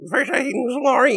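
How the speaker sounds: high squeaky mock voice on Satan's glory; a spooky squeaky whisper of a line. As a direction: squeak it out like a demonic record. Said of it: "some squeaky voice say Satan's glory".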